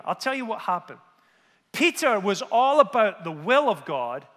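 ordinary talk, nothing marked

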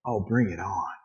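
low, soft, acted yearning; dropped, softened voice of anticipation; low longing. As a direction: say it low and eager. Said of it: "the more I go".

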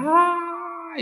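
high, long crying wail; baby wail. Retold or imitated. Mika bursting into tears, a high wailing cry. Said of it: "قام يصيح".

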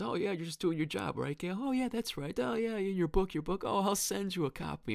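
high, mocking singsong, dripping sarcasm; sarcastic mocking jab. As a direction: needle her in a high sneering voice. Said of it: "she turns and she says".

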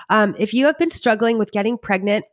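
ordinary talk

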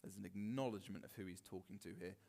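ordinary talk

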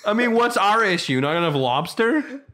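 loud, high mock outrage; mock protest. Canadians protesting to a British man, loud and high. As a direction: act offended, high and loud. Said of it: "'cause we're like".

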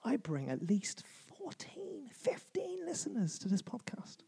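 hushed conspiratorial whisper; slow mock whisper. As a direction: breathe it like a secret boast. Mock boast whispered to mic.

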